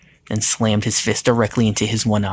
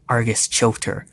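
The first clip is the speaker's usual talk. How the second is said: usual speaking voice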